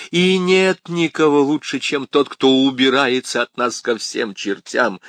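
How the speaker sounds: громкий надрывный крик; кричи зло и отчаянно; frantic shouting hostage taker. A narrator's reading. Крис в истерике кричит. (истеричный крик)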